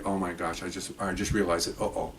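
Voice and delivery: low, soft thinking to oneself; quietly acted dawning realization; hushed realization. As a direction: voice a private uh-oh moment under the breath. Inner realization retold as hushed thought.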